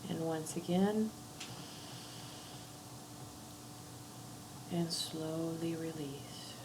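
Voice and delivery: slow, drawn-out meditation guide; unhurried breathing cues with long pauses; guided breathing meditation. Acted. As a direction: lead a breath slowly, letting each phrase fade. Leads a breathing exercise.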